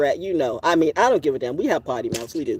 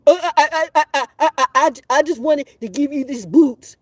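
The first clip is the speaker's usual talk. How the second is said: stammering neighbor; 9 semitones higher, 5 dB louder than usual. Retold or imitated.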